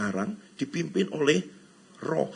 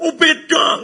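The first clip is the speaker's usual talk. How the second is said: high, louder mock "oh, beda" from a fussy objector; 14 semitones higher, 8 dB louder, much slower than usual. Preacher mimics someone insisting it differs, high and mocking. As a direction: pitch it up like a fussy listener.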